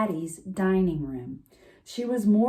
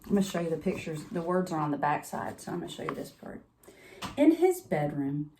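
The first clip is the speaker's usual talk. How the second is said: usual speaking voice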